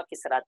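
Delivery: کسرات with no vowel after the s; s closing the first syllable of کسرات; silent middle letter. Shown as a demonstration. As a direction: say کسرات as kis-raat. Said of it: "ان کو ساکن بھی پڑھا گیا ہے".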